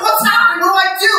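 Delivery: high, loud mock panic; mock-terrified cry surrounded by bears; panicked. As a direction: yelp it like you're freaking out. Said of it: "so I'm freaking out".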